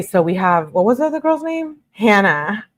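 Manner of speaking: ordinary delivery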